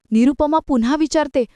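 ordinary delivery, nothing marked

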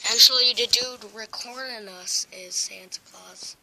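ordinary talk

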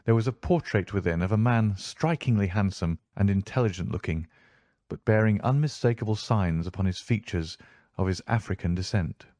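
ordinary delivery, nothing marked

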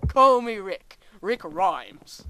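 loud, badly faked British accent; failed British accent. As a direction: belt out a hammed-up attempt at British. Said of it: "That didn't sound British at all".

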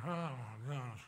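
soft, low, put-on weary moan; mock weary grumble. Preacher imitating churchgoers who won't join praise, mock weary. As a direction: grumble it under your breath, imitating a reluctant worshipper. Voicing bored people during worship.

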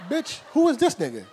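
loud, high mock outrage; retold outraged shout. Comic retelling his yell at the girl's extra man. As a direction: yell it in disbelief.

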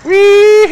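high, loud whooping "wee-hee"; gleeful whoop. Real, not acted. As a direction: whoop with joy like on a ride. Tractor flies, whooping.

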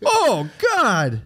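high commentator groan; announcer reacting in disbelief; John Madden commentator. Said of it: "a John Madden the whole time".

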